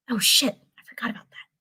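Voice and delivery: quick, hushed whisper. Acted. Natasha whispers her slip so the others won't hear. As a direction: mutter it under your breath, fast.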